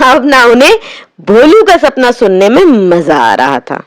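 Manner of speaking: plain reading voice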